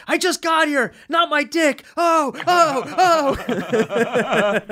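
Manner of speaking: high, screaming Kinison impression; mock Kinison shriek of pain; Sam Kinison. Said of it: "I just hear Sam Kinison going".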